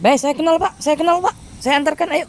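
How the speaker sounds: high-pitched eager helper, voice jumping up; eager offer. Retold or imitated. Grass cutter offering to guide the rich buyer, eager and high. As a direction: offer help keenly, pitched well up.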